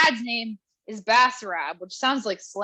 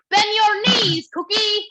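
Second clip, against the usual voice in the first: high, slow, loud coaching call; hiss-shout instructions like a pushy dad at the fence; mock dad yelling coaching from the sidelines; dad coaching through the fence; 9 semitones higher, 6 dB louder, slower than usual. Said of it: "just be like".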